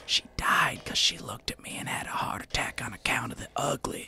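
hushed creepy whisper. Acted. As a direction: drop to a whisper. Rick whispers oddly.

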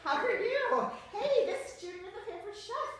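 high, loud, laughing hello; over-the-top cheerful phone pitch; bubbly sales greeting. Acted. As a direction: gush like an eager consultant calling. Role-play sales call opener.

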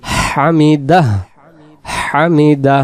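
হামিদা with হা from mid-throat. Shown as a demonstration. Teacher demonstrating the letter from mid-throat, throaty pronunciation. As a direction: draw out হামিদা, breathing the হ from the throat. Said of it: "গলার মাঝখান থেকে".

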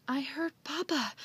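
winded, lightly acted; out of breath. A narrator's reading. Joe telling Andy what he heard, out of breath. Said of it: "Joe said out of breath".

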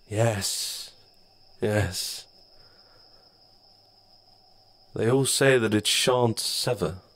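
lightly voiced knowing shadow; patient, condescending dark spirit. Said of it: "the shadow said".

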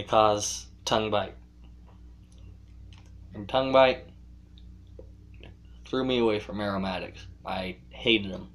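talking around pipe stem; pipe in mouth. Real, not acted. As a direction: lips clenched on pipe. Seen 2: Pipe stem held between lips while speaking throughout.

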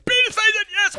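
high-pitched excited yell; wild high urging; frenzied battle cry. Real, not acted. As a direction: shriek it mid-attack. Shouts same words mid-attack.

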